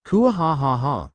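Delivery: slow, hammed-up evil cackle; a drawn-out gloating mock-villain laugh; dragon's smug triumphant laugh; villainous laugh.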